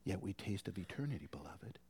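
hushed, breathy whisper, quick. Acted. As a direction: lean in and whisper it like a secret. Preacher drops to hushed awe.

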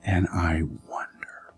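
low, whispered musing; hushed wonder. Real, not acted. Preacher pondering whether their eyes met, hushed. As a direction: draw them in with a whisper. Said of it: "hushed wondering".

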